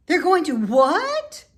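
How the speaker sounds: high, loud shocked scream. A narrator's reading. Wilbur screams at the sheep in shock. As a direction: shriek it in disbelief. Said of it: "screamed Wilbur".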